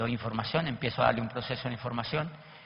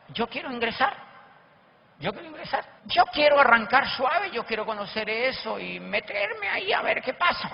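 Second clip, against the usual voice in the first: higher, keen and pushing to join; eager; 9 semitones higher than usual. Retold or imitated. The manager telling the speaker he wants to sign up, eager and higher. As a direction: sound hooked and ready to start. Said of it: "Así, con esa actitud".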